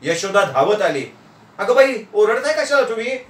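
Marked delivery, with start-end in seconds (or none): 1.6-3.2 high-pitched scolding wife; exasperated, high wifely protest; startled wife. Acted. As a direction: exclaim in a higher voice, taken aback. Startled wife rebukes his shouting.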